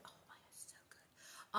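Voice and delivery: hushed, whispered rave; whispered gushing. Real, not acted. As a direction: drop to a whisper of admiration. Gushing about the show.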